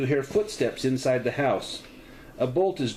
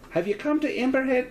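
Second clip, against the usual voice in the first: put-on higher landlady slipping into a Scottish accent; Scottish accent creeping in by mistake; accidental Scottish accent; 13 semitones higher than usual. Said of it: "that turned into a Scottish accent".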